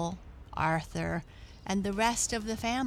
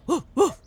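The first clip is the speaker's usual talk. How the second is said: two loud, high imitated barks; dog barking; 10 semitones higher, 11 dB louder than usual. A narrator's reading. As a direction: bark twice like a dog running off. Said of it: "barked the dog as he ran away".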